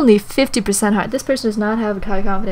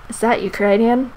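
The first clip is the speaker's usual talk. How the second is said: ordinary delivery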